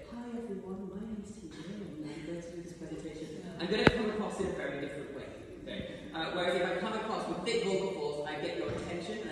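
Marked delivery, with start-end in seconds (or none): 0.1-3.4 softer, timid little voice; meek introduction. Shown as a demonstration. Coach demoing a weak presentation opener. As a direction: introduce yourself meekly. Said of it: "if I came in today and said".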